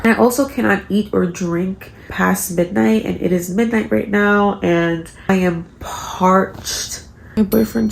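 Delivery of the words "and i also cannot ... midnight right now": usual speaking voice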